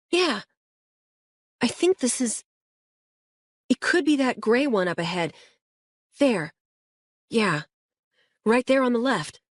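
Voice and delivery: put-on a kid pointing out the house, keen; Luis, excited boy. A narrator's reading. Boy in the back seat guiding the officer, eager.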